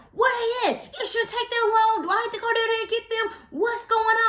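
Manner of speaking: high, indignant mock yelling; boyfriend yelling. Boyfriend demanding the anesthesiologist come, high mock yelling. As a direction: yell impatiently at the staff. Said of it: "he's yelling at people like".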